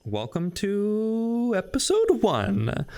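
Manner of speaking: slow, drawn-out host intro; hyped intro. Acted. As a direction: stretch out the welcome like a show host. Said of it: "so now we're actually restarting".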